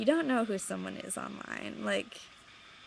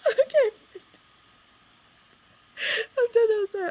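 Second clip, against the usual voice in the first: breathless giggly; 13 semitones higher, 6 dB louder than usual. Real, not acted. Still high after impression.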